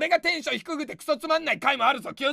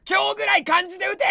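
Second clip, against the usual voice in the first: higher, louder comic bark; mock scolding shout; 7 semitones higher, 5 dB louder than usual. Comedian yelling at a new commenter to type in kanji, mock-angry shout. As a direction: yell the order in fake fury.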